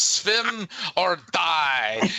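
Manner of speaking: put-on slow, stern German-accented "Swim or die"; slow stern drill-instructor command; German lady's order. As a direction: bark it like a strict swim teacher. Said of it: "She goes".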